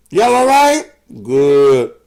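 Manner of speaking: loud, high greeting shout; loud call-out. Real, not acted. Checking in loudly with his listeners, high bright call. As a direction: call out to the whole audience.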